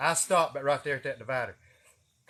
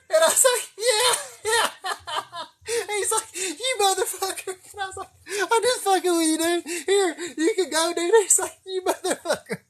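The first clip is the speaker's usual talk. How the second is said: laughing; 14 semitones higher, 3 dB louder than usual. Real, not acted. Retelling the prank, cracking up.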